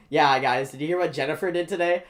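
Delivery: loud, quick mimicking of gossip; mock gossip. Student parroting the gossiping girls back at them, mocking. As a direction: throw their gossip back at them loudly. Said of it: "I'm like".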